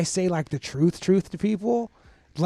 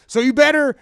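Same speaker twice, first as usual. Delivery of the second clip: louder and higher, warning the new guy; mock stern warning; 6 semitones higher, 7 dB louder than usual. Juvie inmate warning a newcomer, raised mock-stern voice. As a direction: lean in hard on cool like a threat.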